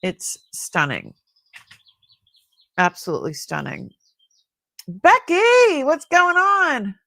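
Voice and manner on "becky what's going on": loud, much higher, worried call. Real, not acted. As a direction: call across the room to Becky. Calling out to Becky.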